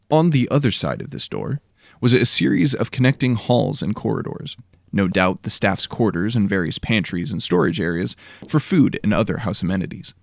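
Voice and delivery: ordinary delivery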